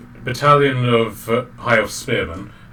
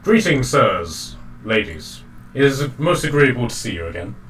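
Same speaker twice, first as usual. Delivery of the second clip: smartly attired gentleman; 2 semitones higher than usual. Acted. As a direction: greet the party with courteous ceremony. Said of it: "a very smartly attired man".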